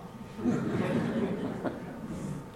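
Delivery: breathy quiet chuckle; soft laugh. Real, not acted. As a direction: laugh softly at the mix-up. Laughs at the misunderstanding.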